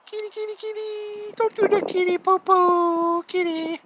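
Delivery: very high squeaky kitty call; high cat-calling voice. Real, not acted.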